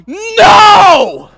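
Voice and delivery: sudden loud shouted no; a parent's shout at the referee from the sideline. Retold or imitated. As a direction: blurt it out at full volume. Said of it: "I just shout".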